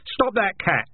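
high, urgent shouted command; shouted order. A narrator's reading. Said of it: "shouted Dark Tan".

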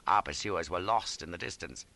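ordinary delivery

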